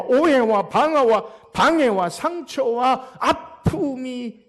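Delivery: impassioned sermon build; forceful preaching. Real, not acted. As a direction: pound out the list word by word.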